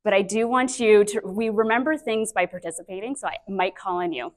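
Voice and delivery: normal talking voice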